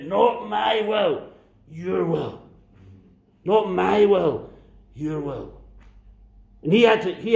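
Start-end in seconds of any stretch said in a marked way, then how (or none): 0.0-5.6 anguished plea. Acted. Jesus crying out in agony.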